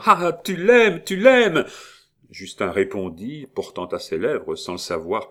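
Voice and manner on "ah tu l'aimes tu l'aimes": much higher, louder despairing cry; anguished cry. A narrator's reading. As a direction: cry it out through her hands. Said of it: "se couvrit le visage de ses mains en criant". (cri désespéré, beaucoup plus aigu et fort)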